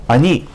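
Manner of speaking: "Ani" with a short clipped a. Shown as a demonstration. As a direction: say "Ani", keep the a brief. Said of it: "it's a shorter a".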